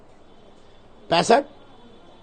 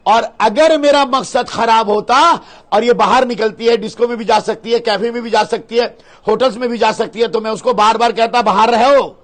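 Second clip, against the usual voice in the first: raised, louder, insistent argument; emphatic protest; 4 semitones higher, 5 dB louder than usual. Acted. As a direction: argue hotly, building to a loud hypothetical order. Heated self-defence, hypothetical urging. (ज़ोर देकर, ऊँची आवाज़ में सफ़ाई)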